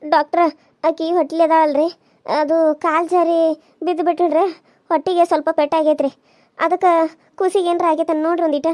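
usual speaking voice

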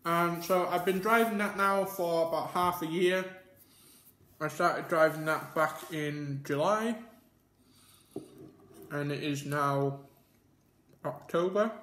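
talking between bites; chewing while talking. Real, not acted. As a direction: mouth partly full. Seen 2: Bite taken at -4; cheeks puffed, chewing mouth shapes throughout words.

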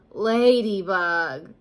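slow, loud, grumpy bear; grumbled. A narrator's reading. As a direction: drag the name out in annoyance. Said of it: "grumbled Bear".

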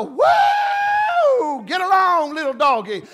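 loud, high whooping cowboy cheer; mock hype preacher. Preacher mocking showy church, rowdy whooping hype call. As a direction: whip up the crowd like a rodeo hand. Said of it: "somebody to get up and go".

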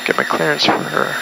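usual speaking voice

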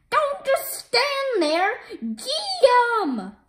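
high, slow villain yell; cartoon villain commanding his robot; shouted order.

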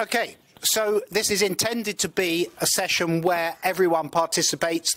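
ordinary delivery

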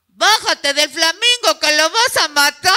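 grito burlón fuerte; burla gritada. Retold or imitated. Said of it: "y era". (burla gritada desde la calle)

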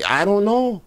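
high-pitched imitation of a housekeeper; housekeeper's voice. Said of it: "housekeeper to say".

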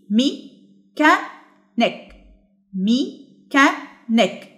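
mechanic in three slow, separated beats; syllable by syllable. Shown as a demonstration. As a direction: pause after each syllable of mechanic. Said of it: "ازاي بنقدر ان احنا نقسمها". (مقطّعة ببطء إلى ثلاثة مقاطع)

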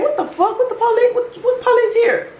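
high-pitched alarmed disbelief; mock panic. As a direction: squeal it in comic alarm. Retold panic at police-like knocking.

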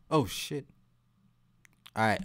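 ordinary talk

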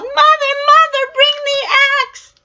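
high, loud desperate yell; Jack yelling. Acted. Jack calling his mother from the beanstalk, high and loud. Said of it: "he yelled".

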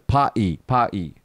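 British "party" as "pa-e", t dropped; local British accent. Shown as a demonstration. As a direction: imitate a British coworker swallowing the t in "pa-e". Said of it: "in his local British accent".

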